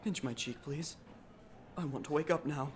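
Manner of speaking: dazed plea. Acted. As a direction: beg quietly as if in a bad dream. Dazed plea, hoping it's a dream.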